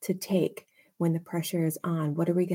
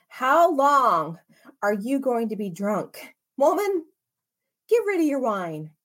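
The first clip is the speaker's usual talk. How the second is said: put-on higher and louder scolding of a drunk woman; stern rebuke; 5 semitones higher, 4 dB louder than usual. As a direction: scold her like a priest catching a drunk. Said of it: "said to her".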